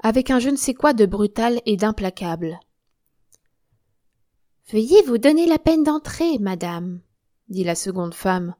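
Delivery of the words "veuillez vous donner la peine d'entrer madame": high, loud show of politeness; obsequious invitation. Acted. Second woman invites Germaine in, obsequiously. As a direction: usher her in with loud courtesy, falling away at the end.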